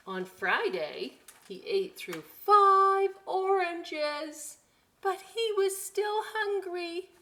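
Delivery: animated read-aloud building up; reading to children. Acted. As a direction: count the days out for little listeners. Reads picture book aloud.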